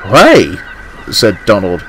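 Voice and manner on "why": loud one-word exclamation of disbelief; startled. Acted. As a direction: burst out in shock. Scottish twin's startled reaction.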